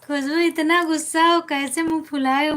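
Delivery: loud, high teasing call; loud playful ribbing. Real, not acted. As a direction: tease her out of her sulk. Teasing a sulking viewer on live.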